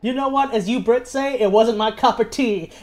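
a hint of a bad posh English voice; terrible English accent. Quoting Spike Lee answering the British interviewer, in a bad English accent. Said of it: "Spike Lee does a terrible English accent".

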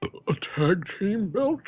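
slow words squeezed out over a burp; talking through a burp. Real, not acted. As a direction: push the phrase through a rising burp. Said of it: "trying to talk through the burp".

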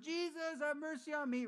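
high, loud cry; the blind man crying out to Jesus; calling out. Retold or imitated. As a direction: call out desperately. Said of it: "he's calling out".

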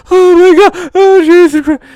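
high, loud panicked yelling; mock panic. Jason running through a haunted house, mock panic. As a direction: freak out at full volume, hammed up. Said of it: "he's in a panic mode".

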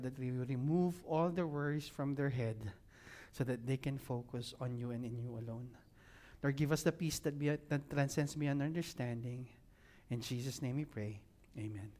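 low, soft spoken prayer. Real, not acted. Pastor prays for people in distress, prayer. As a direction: pray quietly on behalf of the room.

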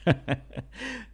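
a quick breathy chuckle, high and soft; soft high laugh. Real, not acted. Radio host laughing at his own joke, light chuckle.

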